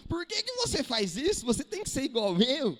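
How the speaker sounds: high, loud spousal nagging; hammed-up indignant demand; acted scolding. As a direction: scold your partner in mock exasperation.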